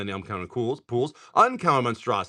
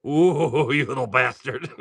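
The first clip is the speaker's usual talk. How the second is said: laughing mock insult. Real, not acted. Teasing a pulled card.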